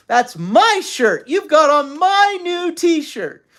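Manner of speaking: loud, slow, high-pitched protest; quarreling. A narrator's reading. Father accusing mother over his shirt, quarreling loudly.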